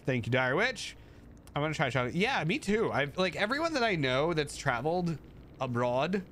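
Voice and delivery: ordinary talk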